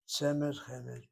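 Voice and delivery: ordinary delivery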